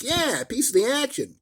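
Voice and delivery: high mock mobster voice; Kirk as gangster. Kirk assures the kid, a hammed-up high mobster voice. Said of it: "And Kirk's like".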